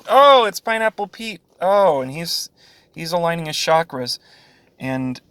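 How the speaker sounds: normal talking voice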